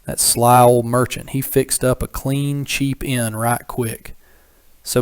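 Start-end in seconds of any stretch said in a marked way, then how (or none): none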